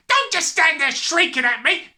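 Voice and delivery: high, loud, exasperated order; yelling. A narrator's reading. Father snapping at his shrieking wife, yelling. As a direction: yell over her shrieking. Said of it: "the father yelled".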